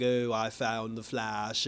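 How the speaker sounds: slow, low villain voice; villain's menace. Acted. Despero gloating on finding the Flash, slow and low.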